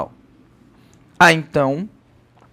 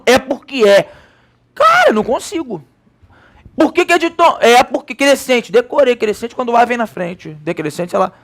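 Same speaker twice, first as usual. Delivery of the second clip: higher-pitched exasperated student voice; mock student exasperation, pitched up; frustrated student; 5 semitones higher than usual. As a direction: act out a student giving up on the logic. Acts out frustrated rote-learning student.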